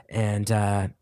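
normal talking voice

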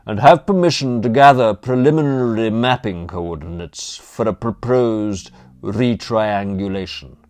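loud, formal proclamation; shouting. A narrator's reading. Butler bluffing dons about survey work, shouting. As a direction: project the official patter across the lawn. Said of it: "he shouted".

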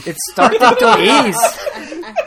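high, slow, laughing voice; wrong character's line. Retold or imitated. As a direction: read it in a put-on voice, giggling. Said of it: "No, no, Fitz says".

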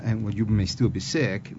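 usual speaking voice

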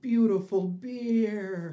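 slow, loud, high-pitched rapture; high, lingering adoration; mock reverence. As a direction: draw it out in hammed-up awe. Hammed-up Irish reverence for holy beer.

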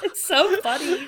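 high squeal through laughter; helpless laughter. Real, not acted. Host cracking up over fooling friends, helpless laughing. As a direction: barely get it out for laughing.

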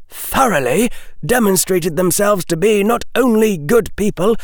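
high-pitched, forceful lecture; old witch. A narrator's reading. Old witch reproving Harry over the line of Merlin, high stern lecture. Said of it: "the old witch said".

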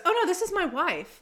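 imitated correction. Imitating wife answering the stranger's repeated question, high-pitched. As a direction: mimic her correcting him, voice pitched well up. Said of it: "like that".

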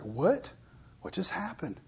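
hushed, breathless disbelief; stunned whisper. Retold or imitated. Imagined fish giver to himself, a stunned whisper. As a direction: whisper it as if you can't believe your eyes.

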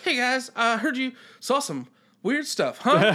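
awkward goofy guy. Retold or imitated. Mimics Jake Johnson's awkward character.

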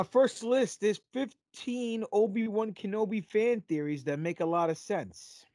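ordinary talk, nothing marked

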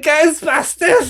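high, loud, drawn-out shout; hammed-up overjoyed fan squeal; excited fan cry. Two fans outside the firehouse, crying out excitedly. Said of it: "We're like".

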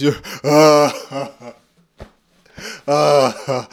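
loud groaning, breathy laugh; aching laughter groan. Shown as a demonstration. Teacher demonstrates busting a gut, loud laughing groan. Acting out belly cramping from laughter.

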